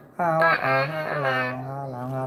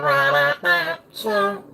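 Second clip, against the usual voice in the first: usual speaking voice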